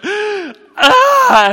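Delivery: high, loud imitated crying burst; gasp, then explode into a wail; hammed-up burst of bawling; mock wail. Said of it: "she just starts crying uncontrollably".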